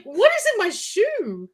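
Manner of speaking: put-on high, whiny kid voice; a kid confused by his wet shoe. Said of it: "they're like".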